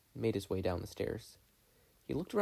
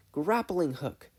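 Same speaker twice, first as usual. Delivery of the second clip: put-on high, loud girl's gasp; gasped exclamation; 7 semitones higher, 4 dB louder, slower than usual. Mabel suddenly remembering her gadget, high and excited. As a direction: blurt it out like a sudden idea. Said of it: "she gasped".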